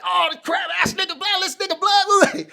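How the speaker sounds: high, loud mocking jeer at the victim; high mock yell of a rowdy bystander; imitating hype crowd. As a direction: egg on the gunman, mocking. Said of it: "you're all the dark moves like".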